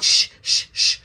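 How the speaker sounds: unvoiced hush; whispered shh; shushing. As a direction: shush the room hard. Friend hushing the room.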